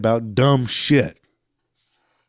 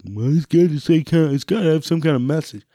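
higher, faster mocking voice of the other side; mock objector; 5 semitones higher than usual. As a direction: parody the critic's whiny argument. Mocking imagined opponent's argument.

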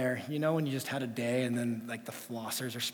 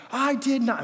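put-on a guilty kid denying it hard; defensive child; 9 semitones higher, 7 dB louder than usual. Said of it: "but she's so defensive".

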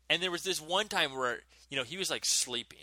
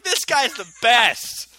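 loud, higher, slow awestruck praise; dazed admiration; 7 semitones higher, 11 dB louder, much slower than usual. Retold or imitated. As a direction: drag it out like you're starstruck by him.

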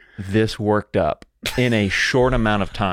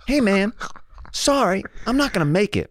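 much higher-pitched imitation; mock impression of guest; 10 semitones higher than usual. Podcaster mimicking his guest's cancellation text, high mock voice. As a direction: put on a high voice for his excuse. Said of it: "is this your impression of me".

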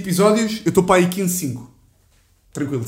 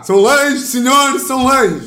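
put-on high trembling old-man announcer; shaky octogenarian radio voice; 8 semitones higher, 5 dB louder, much slower than usual. Shown as a demonstration. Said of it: "Eu também faço bem".

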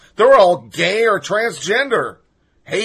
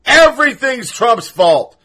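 ordinary delivery

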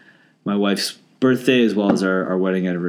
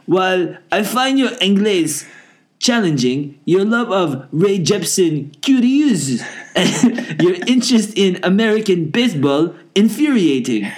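hammed-up fake French accent, higher and slow; 7 semitones higher, slower than usual. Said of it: "please read my emails with the accent".

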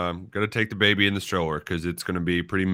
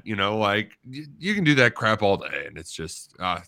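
ordinary talk

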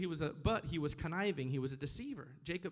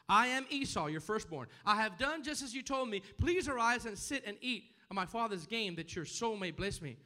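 higher, forceful preacher's reading; scripture reading; 4 semitones higher, 4 dB louder than usual. Acted. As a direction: declare it boldly as Jacob. Reads Jacob's lie from scripture.

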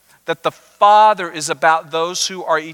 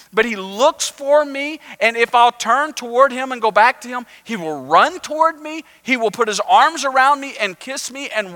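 higher and louder, pleading in disbelief; rising hope; 9 semitones higher, 4 dB louder than usual. Retold or imitated. Sinners hearing the parable, lifted with excited hope. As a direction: voice the outcasts' desperate hope. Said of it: "hope kind of rose in their heart".

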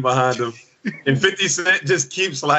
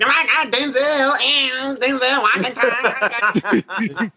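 extra whiny [name] voice; 8 semitones higher, much slower than usual. Retold or imitated. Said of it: "Just like".

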